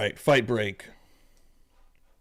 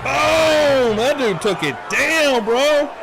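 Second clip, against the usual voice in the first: excited shout; 9 semitones higher, much slower than usual. Real, not acted. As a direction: burst out at the knockout. Watching fight clip, exclaims.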